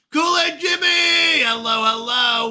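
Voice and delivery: high, loud, drawn-out name call; shouted greeting. Real, not acted. Streamer hailing a viewer who just arrived, shouted greeting. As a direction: holler a big excited welcome.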